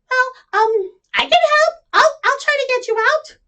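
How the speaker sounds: high, eager little duck voice; a tiny duck offering to help. Acted. Little duck, high and eager, to the stuck animals.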